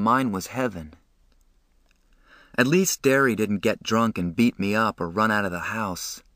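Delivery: plain reading voice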